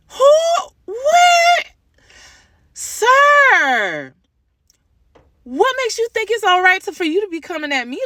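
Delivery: outraged disbelief. Real, not acted. Mother retelling the father's insults, high shocked outrage.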